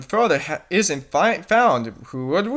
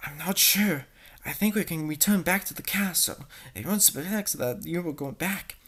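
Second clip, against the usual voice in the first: whispering; 2 semitones lower, 11 dB quieter than usual. Acted.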